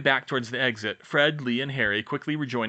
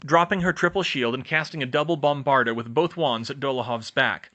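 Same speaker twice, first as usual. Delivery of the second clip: plain reading voice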